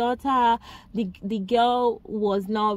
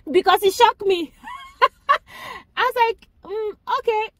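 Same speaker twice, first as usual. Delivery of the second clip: much higher, breaking into laughter; real laughing reaction; 11 semitones higher, 4 dB louder than usual. Real, not acted. Telling viewers the film scene shocked her, laughing. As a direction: laugh through it, pitch shooting up.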